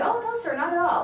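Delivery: high, over-ready mock deference; mock innocence. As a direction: deny it sweetly, pitched well up.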